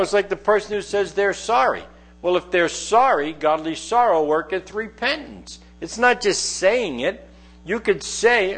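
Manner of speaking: ordinary talk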